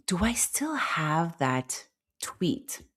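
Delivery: slow whispered aside to herself. Real, not acted. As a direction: breathe it, searching. Searching phone, whispering aside.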